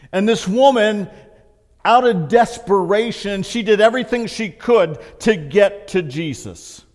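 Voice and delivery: loud, high, driving sermon voice; preaching. Real, not acted. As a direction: preach it with rising fire to the hall.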